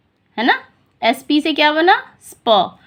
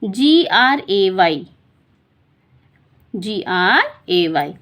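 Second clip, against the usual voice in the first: letters spoken slowly and lower; slow spelling out; 6 semitones lower, much slower than usual. Shown as a demonstration. Teacher spelling slowly to young learners. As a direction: spell it out for the class. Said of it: "जैसे ये".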